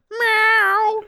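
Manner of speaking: very high cartoon cat meow; hammed-up meow. Imitating young Ocelot meowing for friends, very high hammed-up meow. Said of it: "he fucking meows".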